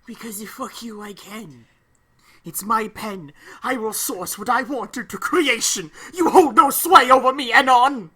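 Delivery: building to a loud, grand declaration; rising from muttered to bellowed defiance; defiant mock rant.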